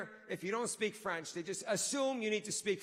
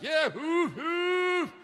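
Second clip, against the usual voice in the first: high, loud whooping fake Frenchman; hammed-up French character; 7 semitones higher, 9 dB louder, much slower than usual. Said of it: "in my French character".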